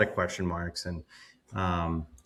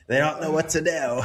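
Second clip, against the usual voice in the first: put-on English accent; 7 semitones higher than usual. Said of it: "terrible English accent, sorry".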